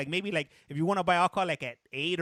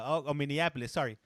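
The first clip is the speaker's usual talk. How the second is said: usual speaking voice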